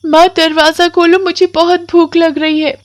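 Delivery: put-on high child voice calling through the door; pleading little girl. Hungry Komal calls her mother to open the door. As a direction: beg to be let in like a hungry child.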